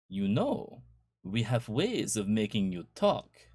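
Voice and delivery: mock German interrogator, slowed down; hammed-up German villain threat; bad German accent. Teasing her husband at dinner, bad German accent. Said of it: "in a bad German accent".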